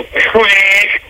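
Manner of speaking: high quacking duck noise; mock quack. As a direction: quack at a whiner. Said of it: "we go".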